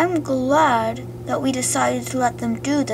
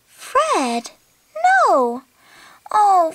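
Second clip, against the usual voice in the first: ordinary delivery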